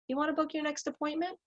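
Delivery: soft, high question, lifting on "appointment"; voice going up unsure. Retold or imitated. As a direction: imitate an unconfident ask, voice climbing through "next appointment?". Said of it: "you hear their voice go up".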